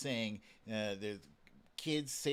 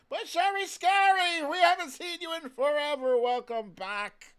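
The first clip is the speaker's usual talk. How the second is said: much higher, loud welcome; host thrilled a regular viewer returned; excited greeting; 10 semitones higher, 9 dB louder than usual. Real, not acted.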